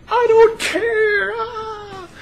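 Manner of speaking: very high, loud, slow crying shout; ecstatic shriek. Retold or imitated. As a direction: burst out in joyful tears. Overjoyed, shrugging off news.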